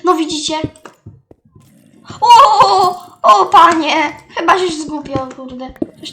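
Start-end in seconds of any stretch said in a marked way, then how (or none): 2.2-4.0 very loud, higher cry of alarm; real startled outburst, much louder; startled shout. Real, not acted. Startled by crowd of zombies. (głośny okrzyk zaskoczenia)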